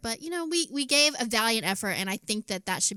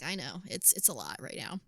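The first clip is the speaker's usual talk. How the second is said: soothing hush; 5 semitones lower, 11 dB quieter than usual. Retold or imitated. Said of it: "And I'm like".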